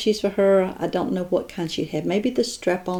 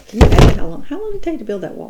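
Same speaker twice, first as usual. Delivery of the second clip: amazed question; 3 semitones higher, 7 dB louder, much faster than usual. Real, not acted. Marvels at the Great Wall.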